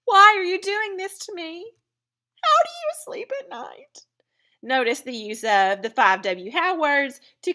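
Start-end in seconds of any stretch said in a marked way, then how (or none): 0.0-1.7 high, loud melodramatic wail; mock pleading. Pleading with the abductor in example. 2.4-4.0 high, whispery mock outrage; dramatic hushed accusation. Accusing the abductor in example.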